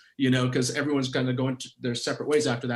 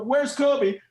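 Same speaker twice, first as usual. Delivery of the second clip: a retold coach calling over the bench; mock call out; 9 semitones higher than usual. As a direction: coach looking for his sub, calling out. Coach searching bench for sub.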